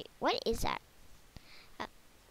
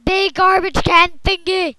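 loud, high excited shout; 6 semitones higher, 15 dB louder than usual. Real, not acted. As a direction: announce it with a big yell.

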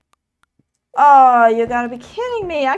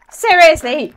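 ordinary talk

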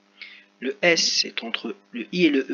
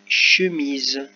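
lecture articulée; 6 dB louder than usual. Shown as a demonstration. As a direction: lecture très lente de « chemise », syllabes étirées. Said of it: "Je lis". (lire « chemise » lentement en étirant le mot)

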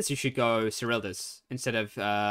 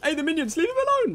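excited shout; 9 dB louder, slower than usual. Real, not acted. Yelling at game action.

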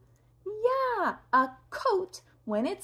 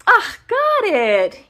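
delighted cry; 8 semitones higher, 10 dB louder than usual. Real, not acted. Clipping a nail, delighted loud cry. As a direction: cheer it out.

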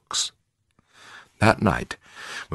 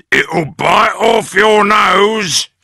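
higher, loud slow menace; Mister Twit; 13 semitones higher, 8 dB louder, slower than usual. A narrator's reading. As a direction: shout the threat slowly. Husband scaring his wife.

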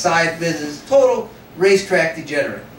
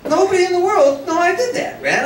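gleeful inner thought; 10 semitones higher than usual. Retold or imitated. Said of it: "I thought".

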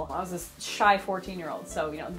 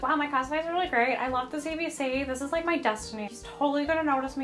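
put-on higher, fast, gushing teen thoughts; imitating giddy self-talk; 4 semitones higher, faster than usual. Teen self convinced the TV man will pick her, giddy rush. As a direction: rattle it off like a starry-eyed kid. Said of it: "my entire thought process being like".

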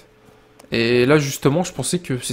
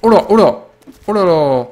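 startled exclamation; 6 semitones higher, 6 dB louder than usual. Real, not acted. Ambushed by enemies in game.

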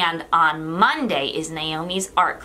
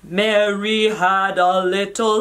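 slow, loud, deep nursery-rhyme recital; low voice; 6 dB louder than usual. Shown as a demonstration. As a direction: recite it slowly in a big low voice. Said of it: "with a very low voice".

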